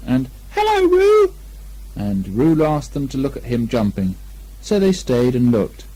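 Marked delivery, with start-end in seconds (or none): 0.5-1.3 very high, small squeak; a timid little squeak; tiny piglet voice. A narrator's reading. Piglet's greeting.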